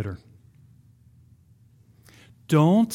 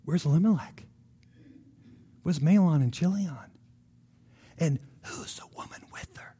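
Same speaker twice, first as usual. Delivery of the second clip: soft, confiding gossip; hushed gossip; 3 semitones lower, 6 dB quieter than usual. Acted. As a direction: lower your voice to a friend, nearly whispering by the end. Townswomen gossip behind Naomi's back.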